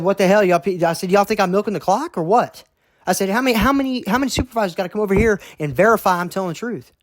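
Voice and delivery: exasperated outburst. Retold or imitated. Fed up with supervisors checking his work, high and fast. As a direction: vent at being doubted.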